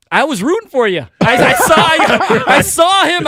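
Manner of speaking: high, loud and laughing; retold excited outburst. Driver telling the officer he was rooting for him, excited. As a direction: blurt it out, voice climbing high.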